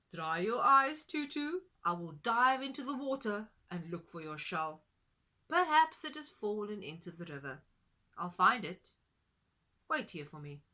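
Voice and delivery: loud, kindly duck voice; a hint of a brave duck; Wild Duck. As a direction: reassure her firmly. Duck comforting Tutu.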